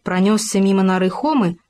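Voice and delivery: usual speaking voice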